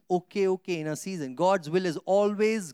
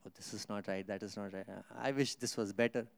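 mock grumbling; 7 semitones lower, 9 dB quieter than usual. Mocks grumbling churchgoer, much softer.

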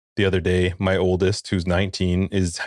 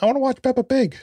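put-on high little girl's plea, pitched much higher; child voice; faster than usual. Little daughter in the morning asking for cartoons, high child voice. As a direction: beg like a three-year-old. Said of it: "my youngest is like".

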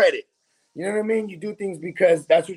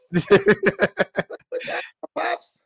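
put-on laughing child voice; 7 semitones higher, much slower than usual.

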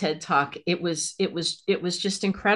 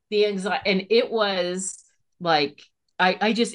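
ordinary talk, nothing marked

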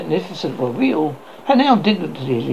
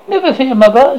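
stout voice; 7 semitones higher, 6 dB louder than usual. A narrator's reading. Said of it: "said the figure in a stout voice".

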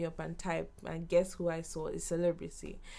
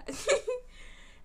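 much higher bright laugh; high giggle. Real, not acted. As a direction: laugh at your own joke. Laughs after joking.

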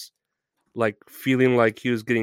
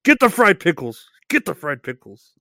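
catchphrase; 3 semitones higher, 4 dB louder than usual. Shown as a demonstration. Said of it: "what his catchphrase is".

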